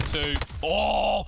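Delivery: count, then explode into a loud high "oh"; excited, high-pitched reaction; countdown into shout. Real, not acted. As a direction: burst out as the kill lands.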